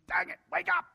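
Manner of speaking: high, loud cry to wake someone; frantic shouting plea; desperate shout. Acted. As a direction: yell at a sleeping friend in panic. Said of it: "And you cry out to him".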